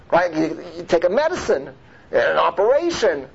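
usual speaking voice

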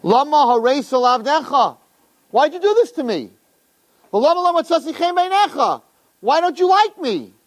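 high-pitched, pleading protest; Moshe complaining to God. Retold or imitated. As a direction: plead upward like a hurt complainant. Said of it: "Vayomer Moshe el Hashem".

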